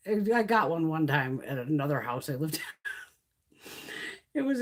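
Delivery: ordinary delivery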